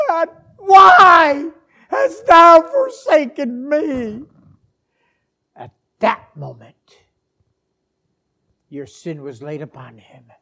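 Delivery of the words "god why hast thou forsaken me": slow, high anguished shout; Jesus crying out. Acted. Forsaken on the cross calling to God, anguished cry. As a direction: wail it out to heaven, drawn out. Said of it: "And Jesus cried out".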